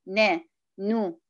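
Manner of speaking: "نا، نو" drawn out slowly, syllable by syllable; demonstrating "نا، نو" at a slow pace; slow syllable demo. Shown as a demonstration. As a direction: say "نا، نو" slowly to show the nasal. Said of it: "زمن الغنة العادية".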